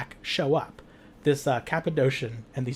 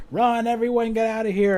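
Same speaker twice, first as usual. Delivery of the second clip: urgent calling out; 8 semitones higher, 3 dB louder than usual. Acted. Vampire telling bar patrons to flee, urgent calling out. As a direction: call out high and sustained, sending everyone running.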